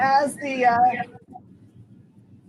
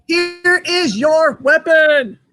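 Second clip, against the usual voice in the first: slow, drawn-out arena announcer boom; booming fighting game announcer; 3 semitones higher, much slower than usual. Acted. Host announces each player's weapon.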